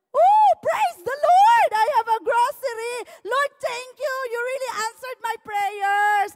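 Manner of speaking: high, loud, ecstatic cry of thanks; overjoyed praise. Retold or imitated. Woman finding groceries shouts thanks to the Lord. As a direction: burst out in joyful praise.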